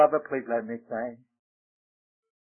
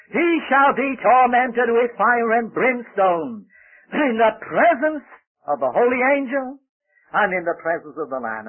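full-force preaching; 7 semitones higher, 5 dB louder than usual. Real, not acted. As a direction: thunder out the warning, drawing out each phrase.

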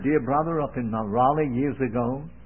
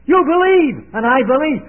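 much higher and louder, a preacher's cry; ringing call; 9 semitones higher, 9 dB louder than usual. Acted. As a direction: lift it up like a call to the congregation. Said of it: "And then he says".

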